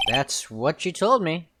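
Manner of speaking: normal talking voice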